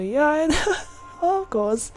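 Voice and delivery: ordinary talk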